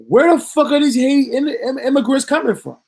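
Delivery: high, loud disbelief, "where the fuck" thrown out; can't believe what he's hearing; incredulous outburst. Real, not acted. Outraged disbelief at the news.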